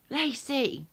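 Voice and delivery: high, loud call down the street; shouting a name. Retold or imitated. Calling the neighbour's girl from the door, loud high shout. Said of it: "I'll shout".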